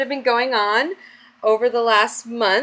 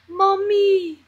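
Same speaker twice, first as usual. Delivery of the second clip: put-on high-pitched drawn-out toddler's call; imitated toddler voice, stretched and high; imitating toddler; 10 semitones higher than usual. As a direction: mimic a little one calling for mother. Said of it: "he'll come over to me and he'll go".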